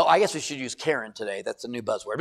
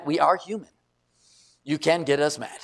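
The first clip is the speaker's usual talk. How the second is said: normal talking voice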